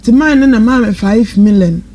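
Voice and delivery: normal talking voice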